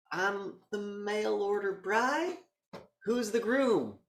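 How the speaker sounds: high, slow mock bride; high mock woman. Voicing the bride character.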